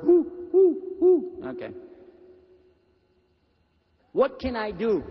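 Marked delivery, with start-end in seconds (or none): 0.0-1.4 high, loud owl hooting; playful owl call imitation. Shown as a demonstration. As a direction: hoot like an owl. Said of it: "You know the bird?".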